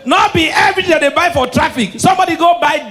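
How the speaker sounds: high, loud shouted telling; loud comic rant. Acted. Comedian ranting to the audience, loud. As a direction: rant it at the crowd. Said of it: "loud comic rant".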